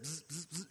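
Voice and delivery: quick, soft buzzing zaps; three short, quiet "bzz" sounds; imitated zapping noise. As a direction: zap them away with little buzzes. Imitating everyone being zapped away.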